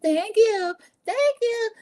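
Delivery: high, mock-sweet string of thanks; put-on high voice, drawn out; gushing thanks. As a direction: imitate someone gushing to each well-wisher. Mimics birthday girl thanking each child.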